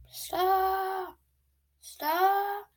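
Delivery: high, soft far-off call of the name; distant call. Retold or imitated. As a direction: call the dog from far away. Dad calling dog from afar.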